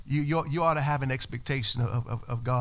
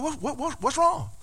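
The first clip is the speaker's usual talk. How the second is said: chicken voice; 10 semitones higher than usual. Acted. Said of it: "the chicken looking at him like".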